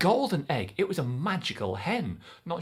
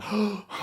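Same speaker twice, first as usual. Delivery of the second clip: a startled gasp; shocked gasp; sharp gasp; 6 semitones higher than usual. Acted. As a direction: catch your breath in fright. Shock at giant waking.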